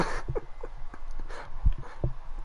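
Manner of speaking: breathy, voiceless laughing fit; helpless laughing. Real, not acted. Streamer cracking up at a rude chat question, helpless laughing. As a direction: crack up, laughing almost without voice.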